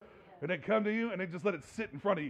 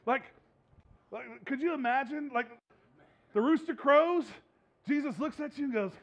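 normal talking voice

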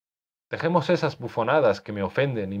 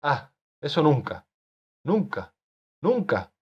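higher, rising cry repeated in protest; shouted; 6 semitones higher than usual. Acted. Augusto crying out to the author against dying. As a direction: refuse with all you have. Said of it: "gritó".

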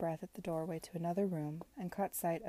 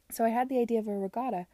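fast and eager, a bit louder; talking too much; 4 semitones higher, 8 dB louder, faster than usual. Acted. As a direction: rush out the idea with excitement. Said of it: "I am inclined to talk too much".

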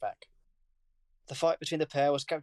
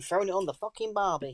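put-on higher-pitched joke Australian accent; mock Aussie accent; 6 semitones higher than usual. Co-host joining the Aussie joke, mock Australian accent. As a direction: chime in as a cartoon Aussie.

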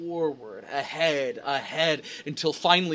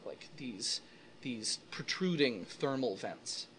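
soft, low game master description; hushed scene narration; 4 semitones lower, 9 dB quieter than usual. A narrator's reading.

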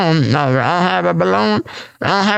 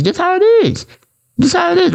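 emphatic high; 13 semitones higher, faster than usual. Acted.

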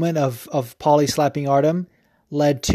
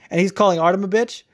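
normal talking voice

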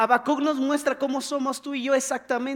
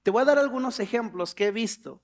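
ordinary talk, nothing marked

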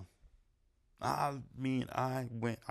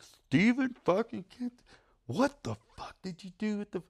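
retold outraged scolding; 13 semitones higher, 7 dB louder than usual. Storyteller scolding friend over spent money, high incredulous outrage. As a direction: stretch each word in mock outrage.

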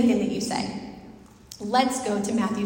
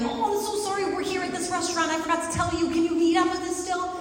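apologetic friend; 7 semitones higher, faster than usual. Retold or imitated. Voicing friend's apologetic text.

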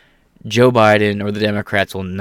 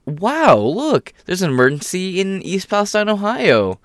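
high-pitched feigned amazement; mock surprise; 9 semitones higher than usual. Host mimics officials suddenly noticing Ohio, mock surprise.

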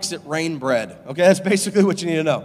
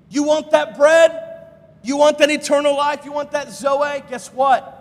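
rousing questions; 7 semitones higher, 4 dB louder than usual. Acted. Preacher rousing the congregation.

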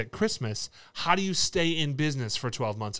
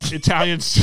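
laughing; 12 semitones higher, 5 dB louder than usual. Real, not acted. Corrected, bursts out laughing.

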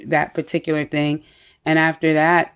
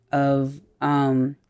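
ordinary talk

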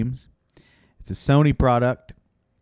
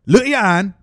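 louder, higher barking coach; mock coach yell; 4 semitones higher, 4 dB louder, faster than usual. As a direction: drill the player like a bossy coach. Coach yelling.

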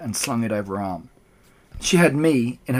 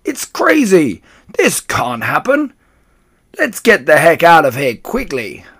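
high, loud, alarmed shouts; panicked shouting; 5 semitones higher, 7 dB louder than usual. A narrator's reading. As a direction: shout it out in a put-on voice. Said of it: "and shouting".

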